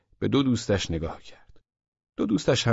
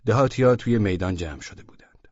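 ordinary delivery

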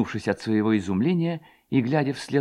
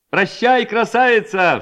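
high, raised farewell call; crying out; 11 semitones higher, 5 dB louder, slower than usual. A narrator's reading. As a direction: cry it out as a second lad. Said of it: "вскричал другой". (высокий громкий выкрик)

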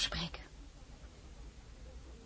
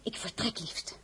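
gefluisterd; 12 dB quieter, slower than usual. Acted. Zachte mededeling aan echtgenoot.

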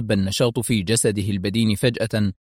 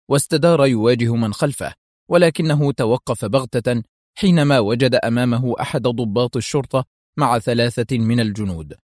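ordinary delivery

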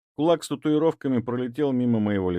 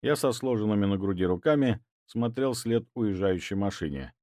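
ordinary delivery

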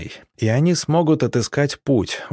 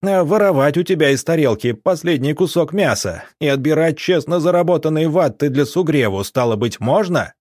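higher-pitched, heated comeback; shouted retort; 6 semitones higher than usual. A narrator's reading. Alexei answers Arina's protest, shouting back. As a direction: fire back indignantly, pitch raised. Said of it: "закричал в ответ Алексей".

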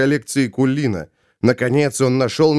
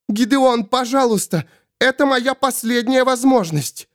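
high-pitched desperate plea; high, urgent entreaty; desperate Svol; 10 semitones higher than usual. Acted. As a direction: beg for a last chance in a high voice. Svol desperately begs Gideon.

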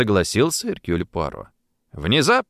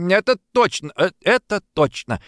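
talking round a mouthful of pudding, pitched higher; chewing through the words; mouth full; 9 semitones higher than usual. A narrator's reading. Boy at the table answers with his mouth full. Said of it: "с набитым ртом".

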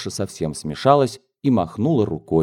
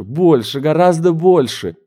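louder and higher, gleeful cry; laughing exclamation; 7 semitones higher, 5 dB louder than usual. Acted. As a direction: outdo her mother's guess with glee. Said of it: "воскликнула с хохотом дочь".

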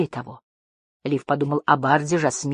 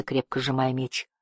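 usual speaking voice

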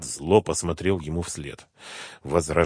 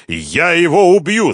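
slow, high, loud threat; desperate shout; 11 semitones higher, 10 dB louder than usual. A narrator's reading. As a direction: cry it out in despair. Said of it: "закричал он в отчаянии". (отчаянный крик)

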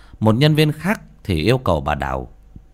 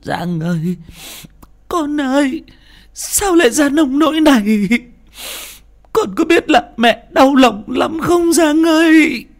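high, loud crying plea to her son; crying mother pleading with her jailed son; tearful mother; 6 dB louder than usual. Acted. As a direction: weep over your son behind the glass. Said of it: "bà rưng rưng".

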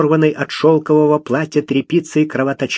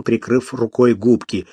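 ordinary delivery, nothing marked

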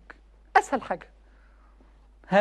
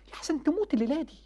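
hushed warning; 4 semitones higher, 8 dB quieter than usual. Real, not acted. Preacher quietly warning young men about dying tonight. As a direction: say it like a grave secret.

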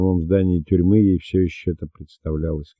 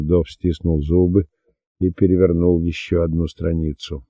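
plain reading voice

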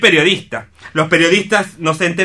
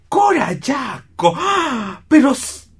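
name called out high and slow, then awed gasp; thrilled fan meeting a famous singer; starstruck outburst; 7 semitones higher than usual. Acted. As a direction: realise you're facing a star and can't believe it.

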